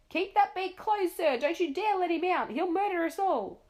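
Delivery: Duke pointing his sword, pleading; bellowing Duke. A narrator's reading. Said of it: "he bellowed".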